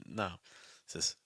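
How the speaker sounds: ordinary talk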